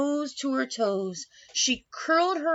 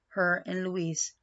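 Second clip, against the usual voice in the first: ordinary delivery, nothing marked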